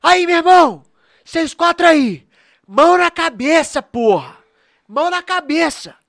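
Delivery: loud mock police command; cop's shout. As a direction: bark the order like a cop. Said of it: "eu só ouço assim".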